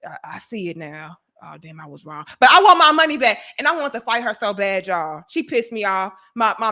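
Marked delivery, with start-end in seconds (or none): 0.0-2.2 low, very soft aside; hushed, deflated mock inner voice; private sheepish admission. Customer's imagined private realization. 2.4-3.4 sudden loud, insistent demand; keeping up the act. Retold or imitated. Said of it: "she had to keep it up".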